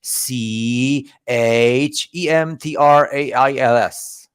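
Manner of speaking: each letter slow and deliberate; a sly, slow letter-by-letter spelling. Shown as a demonstration. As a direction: spell it out as if you can't read the word. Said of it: "so I just have to spell it".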